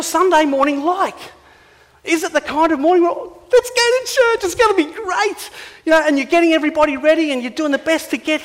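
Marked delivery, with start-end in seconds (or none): 3.1-5.5 much higher, slow, sing-song fake cheer; hammed-up cheerful parent; mock cheerful. Mock cheerful family morning.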